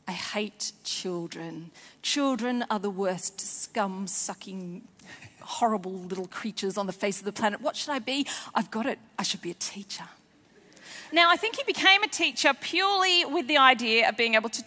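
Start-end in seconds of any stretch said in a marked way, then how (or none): 0.1-1.7 slow hushed whisper; whispered hatred. Retold or imitated. Villain teacher's inner thought. 2.0-10.1 put-on scheming child-hater. Imagined child-hating teacher thinking.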